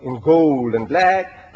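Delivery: ordinary talk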